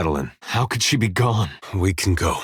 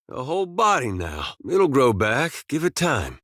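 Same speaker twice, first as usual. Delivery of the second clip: higher-pitched doting voice; fond cooing; 6 semitones higher than usual. Acted. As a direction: coo over a long-lost little friend. Coaxing and doting on little skull.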